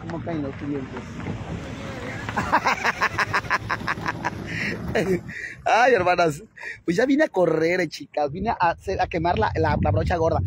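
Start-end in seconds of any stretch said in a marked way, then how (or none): 1.1-5.6 breathy laugh. Real, not acted. Laughs after joke.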